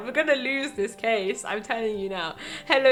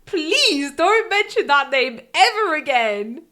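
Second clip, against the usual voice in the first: louder, higher, drawn-out pleading order; exasperated outburst; 5 semitones higher, 7 dB louder, slower than usual. Acted. Nick begging Maya to drop a painful name, exasperated. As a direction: snap loudly at a friend, slowing down. Acted outburst in game dialogue.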